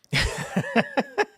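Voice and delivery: high giggle at his own idea; amused laugh. Real, not acted. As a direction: break into a quick, light chuckle. Amused at being overheard with cat.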